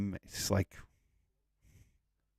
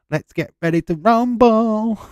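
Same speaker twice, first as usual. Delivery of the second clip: ring announcer; 13 semitones higher, 9 dB louder than usual. Pretend.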